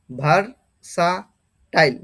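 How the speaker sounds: ভার্সাটাইল slowly split as bhar, sa, tail; each part of ভার্সাটাইল said separately; syllable-by-syllable pronunciation. Shown as a demonstration. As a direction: sound out ভার্সাটাইল with clear gaps. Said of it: "এটার pronunciation বা উচ্চারণ".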